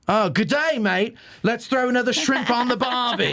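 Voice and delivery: high, hammed-up Australian greeting; bad Australian accent. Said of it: "I can't do an Australian accent".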